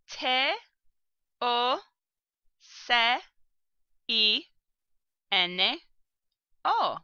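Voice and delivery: each letter of TOCINO said apart, with pauses between; spelled letter by letter. Shown as a demonstration. Teacher spelling the Spanish word for bacon letter by letter. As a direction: spell out T, O, C, I, N, O one letter at a time. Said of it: "Se escribe".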